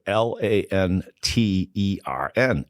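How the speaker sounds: slow, measured challenge to pronounce it; deliberate spelling. Retold or imitated. Teammate testing his accent, slow deliberate spelling. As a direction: spell it out slowly, letter by letter. Retold test word spelled out.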